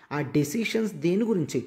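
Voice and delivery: ordinary talk, nothing marked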